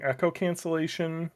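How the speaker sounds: usual speaking voice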